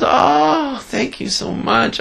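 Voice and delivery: high, gushing thanks from the wife; grateful gushing wife. Retold or imitated. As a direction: pour out relief and thanks, pitched high. Wife thanks finder, retold story.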